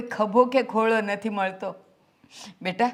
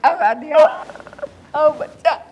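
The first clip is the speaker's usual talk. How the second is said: tearful pleading; 6 semitones higher, 3 dB louder than usual. Acted. Mother urges son to cry.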